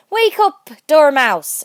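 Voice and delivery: loud high shout; calling out. A narrator's reading. Hatter and March Hare shouting the Dormouse awake. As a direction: holler to wake the sleeper.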